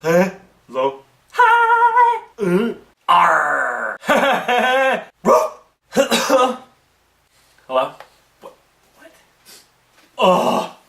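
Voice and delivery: hammed-up, clueless phone pickups in odd voices; bad phone answerers. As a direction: answer the phone as badly as possible, mostly loud blurts and noises. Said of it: "people are not good at answering phones".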